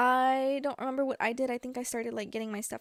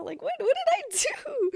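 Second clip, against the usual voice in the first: incredulous; 4 dB louder than usual. Retold or imitated. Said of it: "everybody thought".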